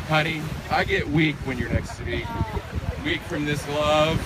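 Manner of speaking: ordinary talk, nothing marked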